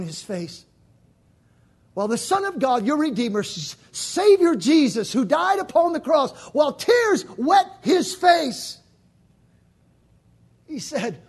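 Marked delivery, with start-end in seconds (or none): 1.9-11.2 full-force preaching. Acted. Preacher building to Jesus's command, full-force preaching. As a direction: stretch each phrase and hit it.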